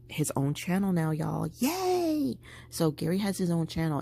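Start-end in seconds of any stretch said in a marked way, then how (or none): 1.6-2.4 high, drawn-out little cheer; excited cheer. Real, not acted. Vlogger celebrating Gary's new channel, excited high cheer.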